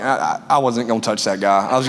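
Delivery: low voice admitting it with a laugh; sheepish confession. Retold or imitated. Friend Bobby admitting he'd never have fought, low and sheepish. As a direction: own up sheepishly, half laughing.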